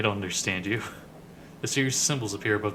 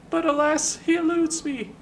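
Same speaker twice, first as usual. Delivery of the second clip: pained high falsetto lament; Ben the Sender; 4 dB louder than usual. Acted. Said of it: "like he is in pain".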